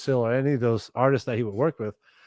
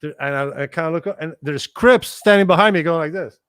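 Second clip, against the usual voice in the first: normal talking voice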